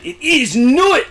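sudden indignant outburst, high and loud; violent objection. Retold or imitated. Chief engineer angrily correcting the stargazing comparison, high shout. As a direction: bark it out like an offended engineer, slowly. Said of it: "he took violent exception to that".

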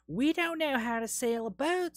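high-pitched put-on Southern accent. [name] explains the boat problem to Naomi, hammed-up southern accent. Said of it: "why I did a southern accent".

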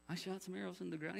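small, very soft mock excuse; acted-out timid mumble; sheepish reply. King's sheepish reply to angry prophet.

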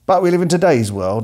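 usual speaking voice

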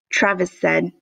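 ordinary delivery, nothing marked

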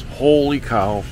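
ordinary delivery

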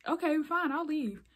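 high-pitched, huffy imitation; mock the lady giving in, pitched well up; huffy mimicry. Lady giving up on the teen, huffy mock voice.

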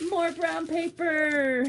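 loud, high exasperated cry; mock outraged shout. Real, not acted. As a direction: yell at the joke gift.

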